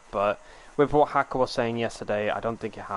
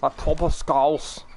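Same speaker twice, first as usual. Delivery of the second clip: slow, put-on Scouse; badly faked Scouse accent; attempted Scouse accent; slower than usual. Shown as a demonstration. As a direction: draw out a butchered Scouse accent. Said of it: "absolutely butchered it".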